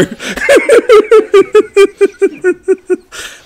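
long, high, loud laughing fit; real, unrestrained laughter. Real, not acted. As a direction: laugh and keep it going. Laughs on at own remark.

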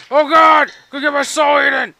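panicked shout. Real, not acted. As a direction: scream it as the monsters come. Zombies appear, player panics.

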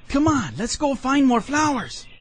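high-pitched beckoning call. A narrator's reading. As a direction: call a child away, pitched well up. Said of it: "he called to Bubba".

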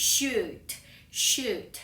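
"shoot" with a drawn-out "oo"; long vowel example. Shown as a demonstration. Teacher giving the example word, "shoot" said slowly with long vowel. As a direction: lengthen the vowel of "shoot", twice. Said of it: "ยาวกว่าอีกค่ะ".

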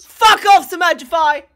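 very loud, high shout; outraged yell. Real, not acted. Streamer tells off a chatter, outraged yell. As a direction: yell it at full blast. Yells at chatter over coin flip.

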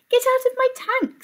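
high indignant fish voice; cross fish. Acted. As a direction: shoo him out, high and huffy. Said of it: "said Adele with a squirt".

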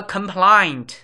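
compliant drawn out slowly; slow model word. Shown as a demonstration. Teacher slowly modelling compliant for learners, drawn-out enunciation. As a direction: stretch compliant clearly. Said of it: "teaching vocabulary".

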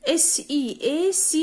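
"S E A C" letter by letter, very slowly; a teacher spelling sea, drawn out letter by letter. Shown as a demonstration. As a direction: spell it out, one letter at a time, for the kids to copy. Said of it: "احفظ معايا".

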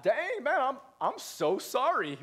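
high, stretched-out, exaggerated sorry; mock apology. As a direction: overdo the apology as if taken aback. Acting out his hurt, mock apology.

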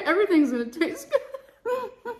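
giggling through the words; laughing. Real, not acted. Laughing at her own joke to the camera. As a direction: laugh as you say it. Laughing at herself over Xanax.

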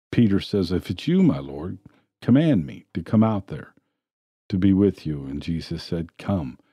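ordinary talk, nothing marked

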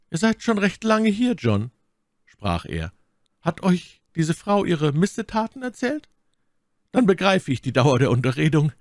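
a stern lord questioning his officer; suspicious baron. A narrator's reading. Lord Winter voiced by narrator. (Lord Winter fragt seinen Offizier misstrauisch, höher)

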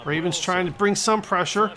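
usual speaking voice

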